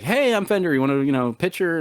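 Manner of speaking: high, upbeat mascot greeting; a cheery character voice; Fender. Retold or imitated. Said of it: "I'm like".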